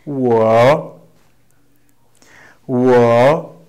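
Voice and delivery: "Wo" drawn out, dipping then rising; model pronunciation. Shown as a demonstration. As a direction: show learners exactly how "Wo" dips and rises. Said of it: "try to pronounce the way I do".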